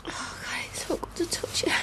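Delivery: soft whispered relief; hushed whisper close to him. Acted. Touching her husband in bed, whispering softly.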